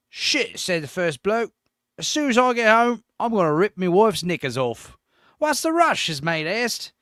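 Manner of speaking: hammed-up, shaky-attempt British accent. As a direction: joke told in an amateur British accent. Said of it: "I can't do a British accent".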